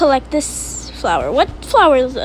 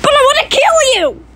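high, insistent plea; whiny protest; 8 semitones higher, 3 dB louder, faster than usual. Real, not acted. Child begging to kill sister in game, insistent. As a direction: plead for it in a high voice.

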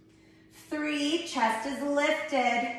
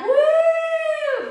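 high cheering woo; excited whoop; 7 dB louder than usual. Real, not acted. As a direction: whoop to fire up the class. Cheering class through last rep.

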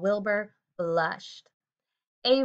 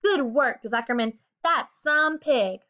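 crowd yelling; 7 semitones higher, 5 dB louder than usual. Acted. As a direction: shout your joy at the fair. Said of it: "yelled the crowd".

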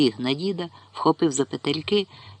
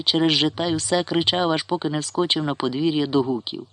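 ordinary delivery

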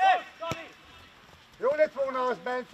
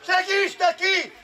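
shouted coaching; 3 semitones higher than usual. Real, not acted. Coach tells player to help teammate, shouting. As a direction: call across to a far player.

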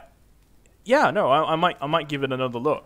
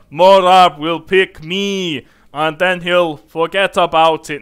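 louder, slow, bad Arnold Schwarzenegger accent; a hammed-up Arnold accent, drawn out; 10 dB louder, slower than usual. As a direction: do a clumsy Arnold accent as the half-orc. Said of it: "Half-orcs now have Arnold accent".

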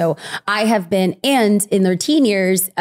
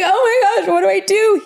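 frantic crying; 12 semitones higher than usual. Retold or imitated. Crying wife panicking to her husband about lice. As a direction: wail it helplessly. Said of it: "I was like".

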